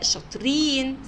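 normal talking voice

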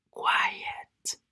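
very soft whisper; hushed, voiceless word; whispered demonstration. Shown as a demonstration. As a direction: whisper it to show the meaning. Repeats quiet in a whisper.

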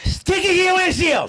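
very high ranting shout; exasperated yell. Acted. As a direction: yell it at a clueless driver. Exasperated rant at drivers.

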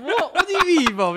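high, loud disbelieving What; mock outraged surprise; shocked disbelief. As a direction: react like you can't believe it. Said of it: "I said, I said".

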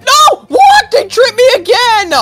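very high, loud cry of disbelief; outraged shout. Acted. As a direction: shriek in protest, drawing out the last words. Fails the game again.

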